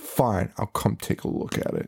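soft, breathy, unimpressed; bored, half-whispered reply; bored sigh. Retold or imitated. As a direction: give in with a tired shrug. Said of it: "she's like".

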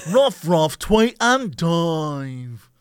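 very slow British announcer voice. Retold or imitated. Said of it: "British announcer voice".

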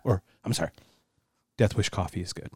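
quiet confiding plug; hushed. Pretend. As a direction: drop to a hush.

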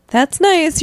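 high, airy grandmotherly pleasantry. A narrator's reading. Grandmother returning to her baking, high-pitched pleasant reply. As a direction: answer pleasantly, pitched well up. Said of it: "your grandmother says".

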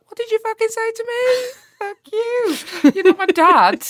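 high, loud outraged snap; furious retort. Retold or imitated. Answering a patronising minister, a high furious outburst. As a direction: fire back in fury at him. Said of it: "And I was just like".

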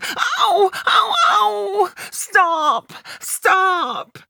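put-on a child in pain crying out to make it stop; pained cries. A narrator's reading. Child to the dentist pulling her tooth, crying out in pain.

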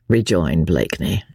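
plain reading voice

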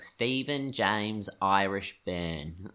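slow, deliberate "Stephen James Irish Byrne"; proud kid. Retold or imitated. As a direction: announce your full name proudly. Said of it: "And I'd be like".

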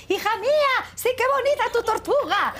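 high-pitched, hammed-up gushing; over-the-top gushing. Shown as a demonstration. Mother demonstrating what she avoided in the restaurant, exaggerated gushing. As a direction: call out like an embarrassingly effusive parent, very high. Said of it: "Que no puedo estar así de".

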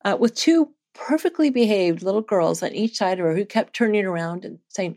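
ordinary delivery

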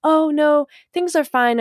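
sweeten it to hide the disgust; fake nice. Mocking a fake-nice brush-off, high and bright. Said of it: "by pretending".

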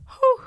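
high, loud mock sob; imitated crying breath. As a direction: act out a gasp breaking into crying. Acting out her crying on phone.

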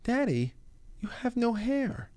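put-on high, slow child's voice; imitating the daughter noticing his bald head; little daughter voice. Dad mimics daughter remarking on his shaved head. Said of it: "she'll go".

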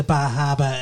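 light Maine accent, dropped R's. Shown as a demonstration. Co-host naming Grams's accent, lightly Maine. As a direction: say Bahaba with a Down East Maine accent. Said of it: "a little bit of the Maine accent".

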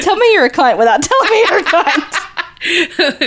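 high, slow, through laughter; helpless laughing tease. Real, not acted. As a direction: squeal the jibe out while cracking up. Teasing co-host while laughing hard.

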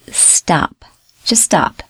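whispered, hushed stop. Shown as a demonstration. Telling the viewer to stop and listen, whispered. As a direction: drop to a whisper to make them stop.

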